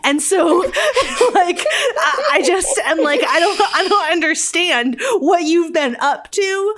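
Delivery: high, loud laughing rant; can't stop laughing at it; laughing exasperation. Real, not acted. Laughing rant about Bran.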